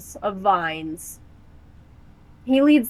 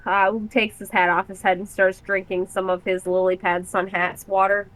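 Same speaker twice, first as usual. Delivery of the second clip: usual speaking voice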